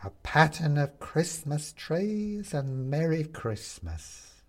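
hungry gleeful skeleton; with relish. A narrator's reading. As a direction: relish every word. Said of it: "says the skeleton with relish".

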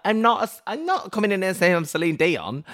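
high, loud defensive whine; indignant protest. Retold or imitated. Singer protesting to karaoke host, indignant high whine. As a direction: protest like you've been unfairly judged. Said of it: "And I was like".

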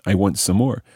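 ordinary delivery

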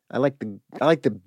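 ordinary talk, nothing marked

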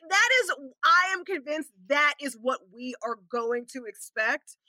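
ordinary delivery

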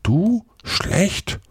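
put-on high-pitched, disbelieving boy; incredulous. A narrator's reading. Huck disputes that Tom is bad, incredulous.

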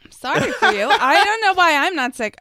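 ordinary talk

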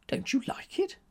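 high, breathless whispered question; hurt whisper. A narrator's reading. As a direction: ask it hurt and hushed.

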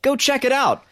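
high, bright upbeat pitch; excited plug. Real, not acted. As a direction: sell the game with excitement. Excited plug for own game.

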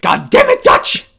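high, loud yelled catchphrase; impression shout. Co-host tries the captain's catchphrase, shouting. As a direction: bellow it like a gruff captain. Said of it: "I can't do the voice".

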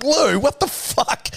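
high, drawn-out shocked outburst to Lou; mock disbelief. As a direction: act stunned at the pile of parcels. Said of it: "and I'm like".

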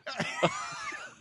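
high screaming laugh burst; shrieking laugh. Real, not acted. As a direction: let out a squealing laugh. Wild laugh after joke.

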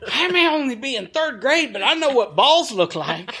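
high-pitched mock kid protest; indignant third-grader. Third-grader insisting on what they saw, mock indignant. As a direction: insist like an affronted little kid, half laughing.

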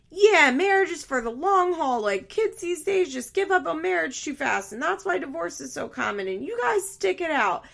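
high, loud, mocking sing-song lecture; mock self-righteous Instagram voice; preachy Instagram influencer. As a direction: parody a smug married influencer. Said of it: "those people that get on Instagram and say".

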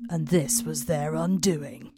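slow dramatic pause on this; ominous line. Acted. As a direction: lean into the doom of their undoing. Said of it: "should have read that in, like, the movie trailer voice".

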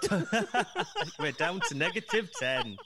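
cracking up, pitch lifted; laughing. Real, not acted. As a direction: talk through laughter. Laughing at joke about negative viewers.

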